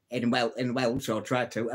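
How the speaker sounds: ordinary delivery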